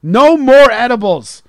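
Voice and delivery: high, loud, slowing into a stubborn shout; insisting to his wife, mock yell. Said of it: "I was like".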